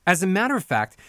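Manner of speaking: ordinary talk, nothing marked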